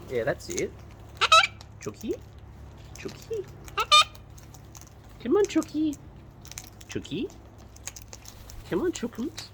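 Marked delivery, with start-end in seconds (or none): 1.8-7.3 high, soft coaxing call; small high pet-calling voice; calling a bird. Real, not acted. Repeatedly calling a bird over. 8.7-9.5 high, soft pet call; soft high-voiced coaxing; coaxing a bird. Real, not acted.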